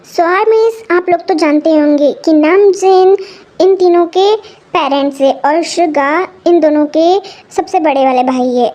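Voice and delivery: ordinary talk, nothing marked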